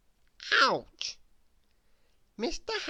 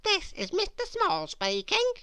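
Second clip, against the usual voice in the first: pretend little voice; 10 semitones higher than usual. Nosey pretends to be Mister Small.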